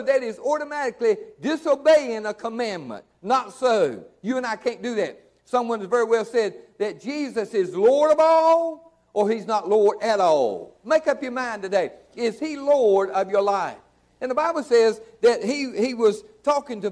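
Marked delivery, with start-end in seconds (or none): none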